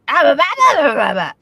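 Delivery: loud nonsense "bah bah" babble; silly voice babble. Pretend. Co-host asked for a silly voice, loud goofy babble. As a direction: blurt out goofy, bouncing gibberish syllables, loudly. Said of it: "I'll go back to the old one".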